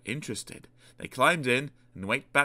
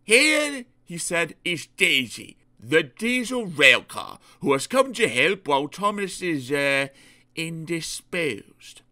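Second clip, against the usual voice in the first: Fat Controller; 6 semitones higher, 4 dB louder than usual. Acted. Fat Controller presenting the diesel rail car to his engines, stately. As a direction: introduce the newcomer with measured authority.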